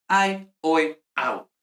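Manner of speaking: "ae, oe, au" recited one by one as written; "ae, oe, au" with both vowels heard; restored Latin pronunciation. Shown as a demonstration. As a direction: glide to e in "ae, oe", to u in "au". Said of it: "pronunciatio restituta".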